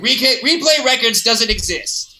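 high, silly puppet. Retold or imitated. Imitating puppets in their store commercial, high silly voice. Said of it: "like, puppets".

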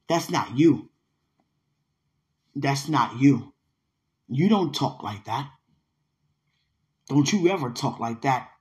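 low, firm and measured scolding; retold stern reprimand. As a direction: lay down the law in a low voice. Retelling grandmother's scolding.

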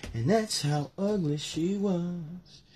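slow, processed sting; a dragged-out echo catchphrase; slowed meme voice; slowed-down tagline. Pretend.

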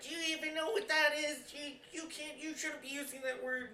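high, hushed imitated teacher; scolding teacher. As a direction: scold softly in a high-pitched voice. Teller voices scolding teacher.

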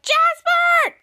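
very high, loud name call; acted angry yell. Girl chasing the boy, high mock-furious yell. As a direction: scream the name after someone. Piper yells after fleeing Jasper.

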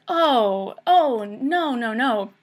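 startled realisation. Retold or imitated. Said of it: "she's like".

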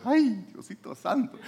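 high, slow exclamation of awe; overwhelmed awe. Acted. Believer feeling God's presence, high awed exclamation.

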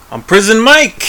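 proud mock tough-guy entrance; Prison Mike intro. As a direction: burst in as a swaggering alter ego. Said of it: "puts a bandana on".

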